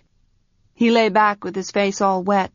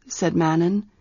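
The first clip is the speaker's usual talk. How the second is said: plain reading voice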